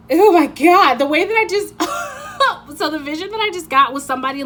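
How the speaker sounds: laughing high and loud; bursting into real laughter. Real, not acted. Laughing at own vision.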